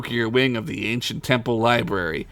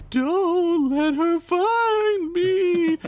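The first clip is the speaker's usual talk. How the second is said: very high, very slow ghostly woman's plea; a trapped ghost begging, drawn out and eerie; spooky lady voice; much slower than usual. Acted. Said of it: "the spooky lady voice".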